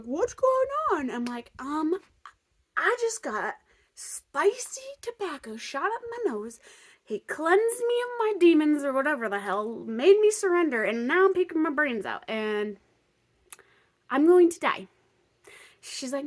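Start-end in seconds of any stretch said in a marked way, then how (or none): 0.0-1.1 much higher, slow questioning voice; Tori's voice. Retold or imitated. Tori asking what's going on, higher pitched. 1.6-14.9 higher and louder, dramatic; melodramatic whine. Retold or imitated. Telling Tori she is going to die, melodramatic.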